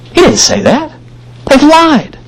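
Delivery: high, indignant accusation; outraged, pitched high; indignant outburst. Pretend. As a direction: cry out that they lied. Reader's indignant imagined reaction.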